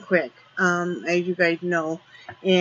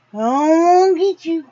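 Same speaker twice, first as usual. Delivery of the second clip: higher and louder, playfully menacing; chase-him tease, pitched up; teasing the dog, higher and louder playful threat; 9 semitones higher, 6 dB louder than usual. Real, not acted.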